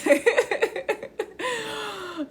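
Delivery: real high-pitched giggle; long amused laugh running out of breath. Real, not acted. Streamer amused that it sounds like them, laughing.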